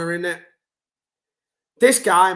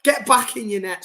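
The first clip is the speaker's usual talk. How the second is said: ordinary talk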